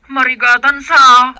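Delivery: loud worried report; husband tells his mother loudly that his wife is struggling. Acted. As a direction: push the worry out loudly.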